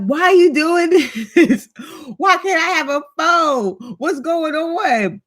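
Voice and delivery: high, whiny mock despair; pleading with God. Mom retelling crying out to God over her phone, high mock whine. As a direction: wail it up to God, half laughing. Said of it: "telling God".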